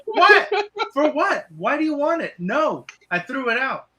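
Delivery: high, incredulous retorts; wife snapping back, hammed-up; mock wife reply. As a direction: answer sky-high like the annoyed wife. Voicing the wife's reply.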